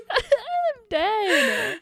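high, loud, helpless laughter; real laughing fit. Real, not acted. Friend cracking up at the mom's church story, laughing hard. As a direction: squeal it out through laughter.

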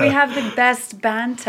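slow, put-on British accent. Teasing with the word he hates, British accent. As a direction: draw it out in mock British. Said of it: "in a British accent".

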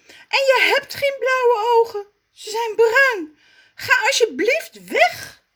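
very high, loud, whining cry; exasperated Zaza. Acted. Zaza, fed up with Raf, wails very high. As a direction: wail at him in despair. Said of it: "zegt ze".

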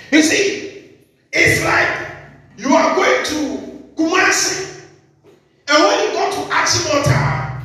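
high, driving sermon voice; preaching. Acted. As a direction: preach it out to the church.

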